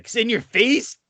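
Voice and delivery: high-pitched mock in-your-face jab; mock Andy taunt. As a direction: throw it out high, imitating a taunt. Imitates Andy's in-your-face line.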